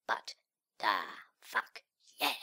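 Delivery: ordinary delivery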